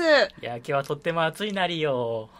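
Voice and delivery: squeaky stock anime nerd; otaku voice. Pretend. Playing otaku chat.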